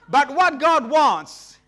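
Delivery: preaching climax. Real, not acted. Sermon building to climax.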